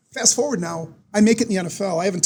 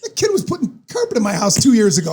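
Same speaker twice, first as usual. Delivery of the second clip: laughing; 3 semitones higher, 5 dB louder than usual. Retold or imitated. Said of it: "he's like".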